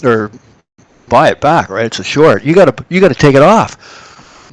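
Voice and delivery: a trade desk guy leaning on you; pushy trader yelling. Retold or imitated. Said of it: "Guy goes".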